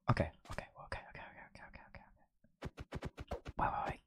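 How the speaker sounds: whispered, barely audible; nervous whisper. Real, not acted. Trying not to be noticed by his hero, nervous whisper. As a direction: whisper it under your breath. Hiding from his idol in game.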